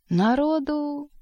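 high, drawn-out lament; exaggerated dismay. A narrator's reading. Koroviev to Margarita about the crowd at the ball, exaggerated dismay. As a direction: stretch the word out high, as if it pained you. Хватается за щеку, будто болит зуб.

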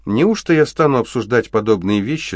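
put-on a girl putting on grand airs; with dignity. A narrator's reading. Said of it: "с достоинством произнесла Энджел". (с достоинством, чопорно)

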